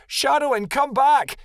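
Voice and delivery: high, loud desperate call; crying out. A narrator's reading. Jade cries to her brother to return, high and loud. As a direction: beg him back at the top of your voice. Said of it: "Jade cried".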